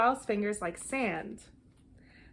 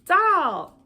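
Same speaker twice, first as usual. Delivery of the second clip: bright, loud burst on the word; excited; 9 semitones higher, 12 dB louder than usual. Acted. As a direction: sing out the name of the dish. Child excitedly names the dish.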